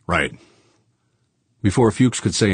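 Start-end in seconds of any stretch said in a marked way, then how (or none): 0.1-0.4 understated reluctant assent; grudging. A narrator's reading. Tycoon yielding after a glare, mildly grudging. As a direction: give in grudgingly. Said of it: "said grudgingly".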